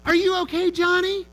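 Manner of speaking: high, loud worried call across a field; full-voiced high yell; mom yelling from the stands. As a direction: holler it out to your kid from the bleachers. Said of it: "his mom yells from the stands".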